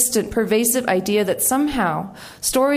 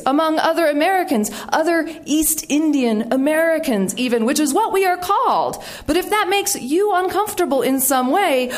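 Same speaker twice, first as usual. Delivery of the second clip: high, declaiming rebuttal with heavy emphasis; mock-formal indignation; 6 semitones higher than usual. Writing back to the radio producer, mock-formal indignation. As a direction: read out a pointed reply grandly, pitched up. Acts out indignant written reply.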